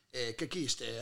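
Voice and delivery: low, soft mock Italian gibberish, in a Barese accent; hammed-up Italian with a low, hushed voice; fake Italian dialect. Comedian faking Italian lines in an Italian accent, low and soft. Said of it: "I go like this".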